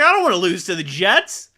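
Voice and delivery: loud mock wail of a fan; hammed-up tearful complaint; mock crying. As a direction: imitate an upset fan crying. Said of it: "this girl's crying".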